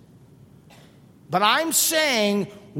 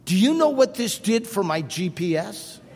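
ordinary delivery

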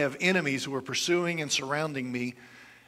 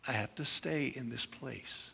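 normal talking voice

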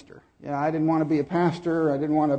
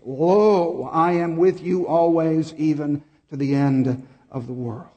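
slow, louder King James recitation; solemn scripture reading; 5 dB louder, slower than usual. Real, not acted.

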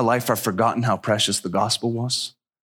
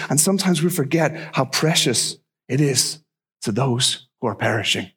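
normal talking voice

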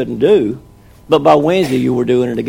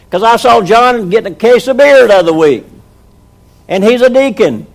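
louder, higher stubborn rant; heated refusal; 6 semitones higher, 5 dB louder than usual. Retold or imitated. Husband refusing his wife, worked-up louder rant. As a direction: dig in and refuse, getting worked up.

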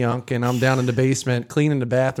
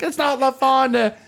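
high exasperated snap; annoyed correction; 10 semitones higher than usual. Retold or imitated. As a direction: set him straight. Wife correcting.

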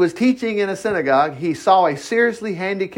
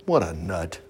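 scoffing aside; 12 semitones lower, 7 dB quieter than usual. Real, not acted. Scornful aside, repeated.

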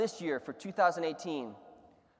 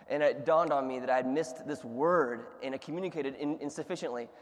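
ordinary talk, nothing marked

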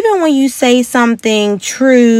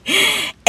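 high, breathy laugh; real laughter; burst of laughter; 11 semitones higher, 6 dB quieter than usual. Real, not acted. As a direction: crack up at the joke. Cracking up at the remark.

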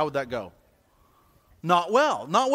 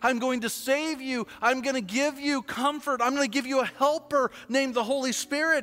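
raised, higher preaching voice over music; sermon climax over music; 9 semitones higher than usual. Acted. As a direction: drive the message home like a pastor.

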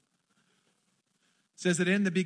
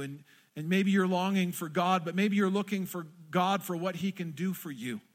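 ordinary talk, nothing marked

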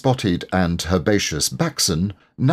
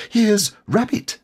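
high little Piglet voice, glad; a small glad squeak of relief; gladly. A narrator's reading. Piglet to Eeyore, relieved Rabbit has come, high and glad. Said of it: "he said gladly".

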